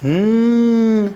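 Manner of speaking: sustained n sound for the class; stretched n sound. Shown as a demonstration. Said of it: "نون صداش".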